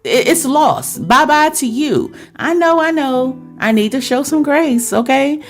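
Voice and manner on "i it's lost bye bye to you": usual speaking voice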